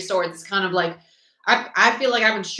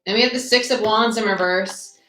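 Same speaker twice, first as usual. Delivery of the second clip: usual speaking voice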